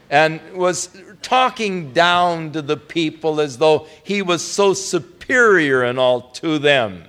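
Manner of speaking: ordinary talk, nothing marked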